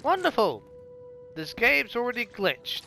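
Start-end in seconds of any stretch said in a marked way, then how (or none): 0.0-0.6 high sarcastic cry of wonderful. Real, not acted. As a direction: fake delight, dripping sarcasm. Game glitched.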